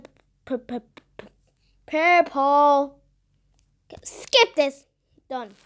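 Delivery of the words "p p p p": whispered stammer. Real, not acted. Stumbling over the color name.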